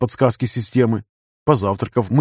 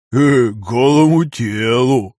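smirking; much slower than usual. A narrator's reading. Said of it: "заулыбался Вася".

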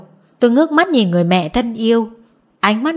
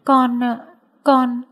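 choked up; 3 semitones higher, 3 dB quieter, much slower than usual. A narrator's reading. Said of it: "Tôi nghẹn ngào".

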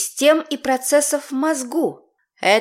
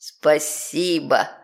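demon voice; 7 semitones lower, much slower than usual. Acted. Demon thanking.

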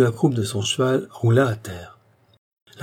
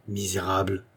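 low, softer word chewed between the teeth; muttered insult; 3 semitones lower, 6 dB quieter than usual. A narrator's reading. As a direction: mutter it with contempt through gritted teeth. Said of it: "en mâchant entre ses dents". (marmonné entre les dents, avec mépris)

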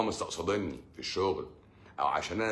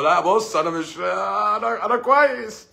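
squeaky protest; 12 semitones higher, 8 dB louder, slower than usual. Acted. Patient protesting he is fine.

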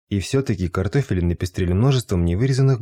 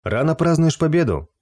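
higher, eager exclamation; exclaiming; 8 semitones higher than usual. A narrator's reading. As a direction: cry it out boastfully. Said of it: "воскликнул Субару".

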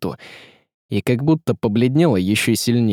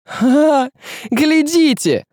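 high hysterical cry; screamed; 3 dB louder, much slower than usual. A narrator's reading. Tsaritsa to the staring crowd, screaming. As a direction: scream it at the onlookers. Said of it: "закричала Всеслава".

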